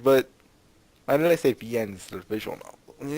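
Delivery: ordinary talk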